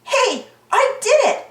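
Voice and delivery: usual speaking voice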